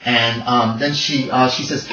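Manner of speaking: ordinary talk, nothing marked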